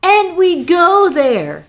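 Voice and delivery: slow, loud, high boast; mock-triumphant declaration; brash employee. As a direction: land each word with bravado. Employee boasting in improvised scene.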